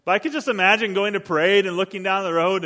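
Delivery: ordinary talk, nothing marked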